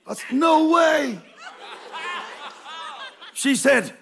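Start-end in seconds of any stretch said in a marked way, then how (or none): 0.3-1.2 higher, louder shocked protest; shocked denial. Retold or imitated. David to Miss Hogan, loud shocked denial. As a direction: shout it in disbelief.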